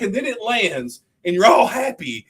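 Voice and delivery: high, loud, giddy shouting with laughter; relieved shout. Retold or imitated. Comedian's bit, landing safely, high giddy relieved shout. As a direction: yell it like you just survived. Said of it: "He's like".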